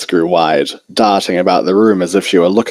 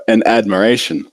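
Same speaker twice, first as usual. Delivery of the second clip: plain reading voice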